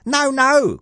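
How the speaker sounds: very high protesting lad; boy crying out. A narrator's reading. Jack contradicting his mother, boy crying out. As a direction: cry it out in a piping boy's voice. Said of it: "cried Jack".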